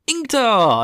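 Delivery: high, loud cheer of a name; excited hailing. Real, not acted. Storyteller greets a favourite's lucky arrival, excited cheer. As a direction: cry it out in delight.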